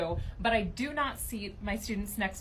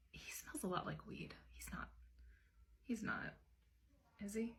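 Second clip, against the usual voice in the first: an unvoiced whisper, much softer; whispered gossip; 14 dB quieter than usual. Teacher whispering to a colleague about another teacher, gossiping quietly. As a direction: lean in and breathe it as gossip. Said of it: "confiding whisper".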